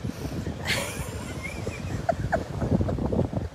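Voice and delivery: long, high, wheezing laugh; crying-laughing fit. Real, not acted. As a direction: laugh yourself to tears. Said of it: "I'm literally crying laughing".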